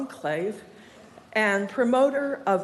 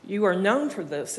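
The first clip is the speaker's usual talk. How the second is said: ordinary talk, nothing marked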